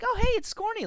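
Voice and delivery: high-pitched, playful greeting; delighted greeting. Acted. Spotting a teammate starting to jig, delighted greeting.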